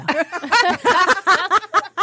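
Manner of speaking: high burst of laughter; big laugh. Real, not acted. Laughing at her own rudeness story.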